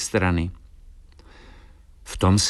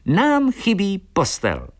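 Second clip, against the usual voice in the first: high, slow, emphatic bird voice; little swallow's verdict; 11 semitones higher, slower than usual. A narrator's reading. Swallow tells his mate what they lack, high and decisive. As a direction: declare the discovery high and deliberately.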